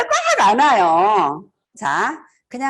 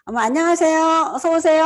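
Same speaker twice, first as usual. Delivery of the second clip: higher, lively welcome; bright welcoming greeting; 6 semitones higher, 3 dB louder than usual. Shown as a demonstration. 손님을 반기는 높고 밝은 환영 인사. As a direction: sound delighted they came. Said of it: "하는 것과".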